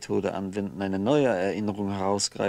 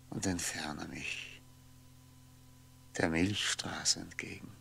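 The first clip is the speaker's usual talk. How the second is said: whisper; 2 semitones lower, 9 dB quieter than usual. Acted. Dreaming of floating away.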